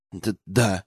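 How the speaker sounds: low, timid stammered answer; trembling stammer. A narrator's reading. Арсений confirms he has the ring, trembling. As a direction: stammer it out faintly. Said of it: "дрожащим голосом пробурчал Арсений".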